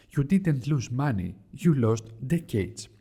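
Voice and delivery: ordinary talk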